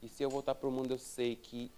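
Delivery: low, soft, sinking prayer; hushed confession. Retold or imitated. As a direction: confide it quietly to God. Retold confession to God sinking low.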